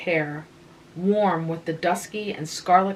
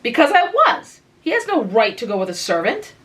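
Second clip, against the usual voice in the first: much higher, louder indignant outburst; hot retort; 5 semitones higher, 5 dB louder than usual. Acted. Anne flares up at her sister, indignant and loud. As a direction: flare up like a cross young girl.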